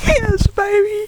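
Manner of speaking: high, slow triumphant cheer; elated. Real, not acted. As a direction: savor the win. Celebrating the deer.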